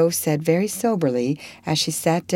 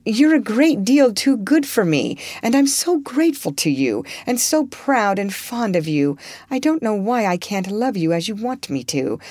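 high, strained, tearful refusal; distressed Joe; 4 semitones higher than usual. Acted. Joe refusing Laurie's love, higher and strained. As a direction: let her voice climb as she struggles. Joe's refusal read in character.

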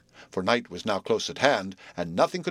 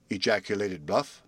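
ordinary delivery

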